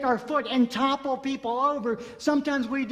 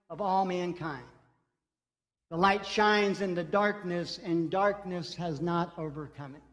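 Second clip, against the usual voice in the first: calm, measured Bible reading; scripture reading; 5 semitones lower than usual. Acted. Reads scripture aloud.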